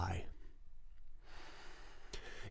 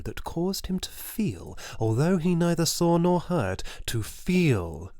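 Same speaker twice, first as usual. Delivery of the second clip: higher and louder, climbing; rising intensity; 8 semitones higher, 5 dB louder than usual. A narrator's reading. Narrator describing Death's shadow, rising high and loud. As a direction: swell up with feverish dread.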